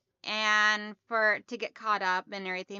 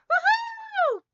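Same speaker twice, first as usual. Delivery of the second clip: loud, much higher cheer of joy; excited whoop; 6 dB louder than usual. Real, not acted. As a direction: cheer loud and high for a friend's news. Cheering a viewer's big purchase.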